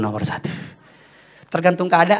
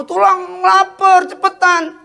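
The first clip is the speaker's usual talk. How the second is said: high, slow, loud whine; impatient little relative; 6 dB louder, slower than usual. Retold or imitated. Hungry child hurrying uncle along, high insistent whine. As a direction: drag out an urgent, high-pitched demand. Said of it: "keponakan saya minta".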